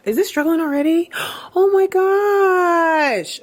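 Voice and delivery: high, slow gasp of dismay at the plant; surprised gasp. Real, not acted. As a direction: gasp and stretch out the oh my gosh. Finding a struggling plant.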